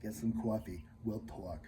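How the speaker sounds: a toned-down New York coffee talk accent, imitated. Reviewer imitating the film's New York coffee talk accent. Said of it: "doing, like, an accent".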